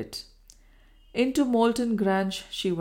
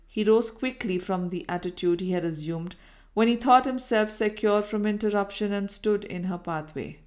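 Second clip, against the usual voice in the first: usual speaking voice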